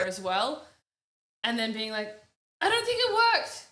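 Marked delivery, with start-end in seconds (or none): 2.6-3.7 loud, much higher exclamation; panicked cry. Acted. Woman whose jugs failed against the fire, high panicked cry. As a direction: cry it out in alarm.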